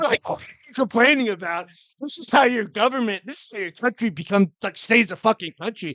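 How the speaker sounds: loud, high indignant rant. Real, not acted. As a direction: rail against complainers. Rant at complaining Europeans.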